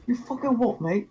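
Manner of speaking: high-pitched Cockney challenge; hammed-up Cockney bloke; British accent. Shown as a demonstration. Friend asked to talk Cockney on stream, mock British accent. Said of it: "talk like a British man".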